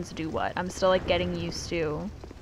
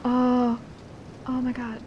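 loud gasping oh my god; overwhelmed by beauty, gasping; awestruck; 4 semitones higher, 9 dB louder than usual. Real, not acted. Awed by pretty scenery.

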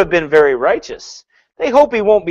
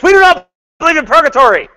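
high, loud, fast mock yell; hammed-up yelled denial; 12 semitones higher, 6 dB louder, faster than usual. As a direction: shout it like bold capital letters. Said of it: "It's like they're yelling at you".

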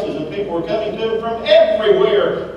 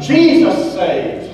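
proclamation; 5 semitones higher, 5 dB louder, much slower than usual. Acted. Preacher's climax proclamation.

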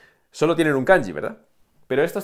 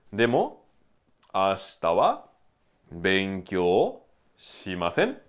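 benkyou shimasen drawn out slowly, ending on a rise; slow model sentence; 5 semitones lower, slower than usual. Shown as a demonstration. Teacher models the negative slowly for students. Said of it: "model sentence".

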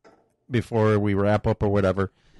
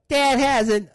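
put-on much higher kid voice tattling; snitching kid; 13 semitones higher, slower than usual. Son insisting to the cop, high tattling kid voice. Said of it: "He's like".

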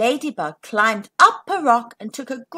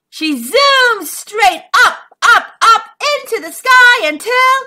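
rising excitement; 11 semitones higher, 9 dB louder than usual. A narrator's reading. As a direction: build it up and up. Climactic narration.

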